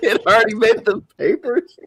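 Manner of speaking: high, helpless laughing through the words. Real, not acted. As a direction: repeat the punchline while cracking up. Laughing as he repeats punchline.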